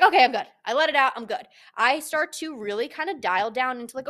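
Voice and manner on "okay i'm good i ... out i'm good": loud, quick dismissive I'm-fine; mock brush-off. Mocking the wrong kind of step forward, loud and breezy. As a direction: wave it away too fast. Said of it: "I don't mean take a step forward as like".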